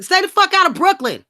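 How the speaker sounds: high, loud shouted warning; emphatic shout. Acted. Repeating the warning to anyone crashing in Brooklyn, shouted. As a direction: yell it like a threat.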